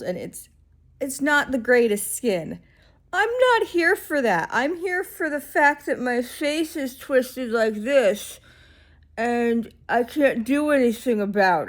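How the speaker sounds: loud, drawn-out exasperated retort; indignant. Retold or imitated. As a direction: protest with mock outrage, stretching key words. Acted indignant retort to clerk.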